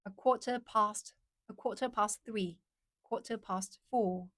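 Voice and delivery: careful British accent, "quarter" without R's; measured British "quarter past". Shown as a demonstration. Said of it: "British accent".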